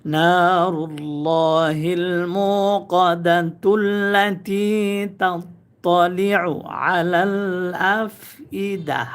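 মুকাদাতুল্লাতি chanted with the তু joined, no stop; slow chant, linking তুল্লাতি without a pause; joined recitation. Shown as a demonstration. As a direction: run মুকাদাতুল্লাতি straight on into তাত্তালিউ. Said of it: "তাহলে তুল্লাতি বলতে হবে".

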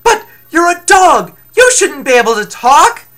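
high, loud shouting; screamed disbelief. Acted. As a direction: yell it in shocked disbelief. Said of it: "screamed Apollo".

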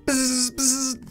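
two short high buzzes; vocal electric zaps; imitated buzz. Imitated battle sound effect.

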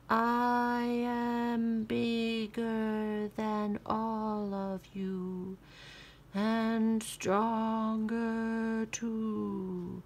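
slow, drawn-out boasting mummy. Acted. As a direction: groan it slowly. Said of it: "boasted the mummy".

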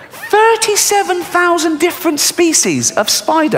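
high, slow, disbelieving; incredulous repeat. Shown as a demonstration. Comedian repeating the spider count to the audience, high and incredulous. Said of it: "repeated for effect".

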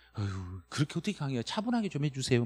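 softly voiced, mildly exasperated plea; weary complaint. Student asks teacher to lecture calmly, mild weary complaint. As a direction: lightly imitate a put-off student, softly.

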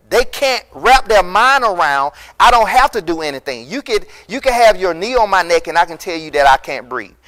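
ordinary talk

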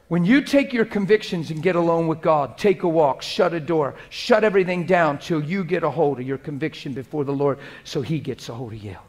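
normal talking voice

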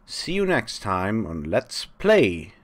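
slow, loud show sign-off; outro sign-off. Acted. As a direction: wrap up like a host. Signing off the episode.

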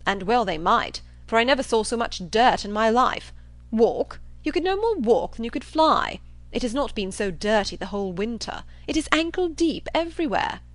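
emphatic, scoffing exaggeration; blustering John Thorpe. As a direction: pile it on with force.

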